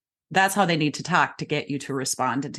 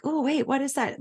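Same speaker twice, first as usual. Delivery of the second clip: high, puzzled mock discomfort; mock pained wince; 8 semitones higher, 4 dB quieter than usual. Shown as a demonstration. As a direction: act as if a noise pierces your ears. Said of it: "it's piercing your ears".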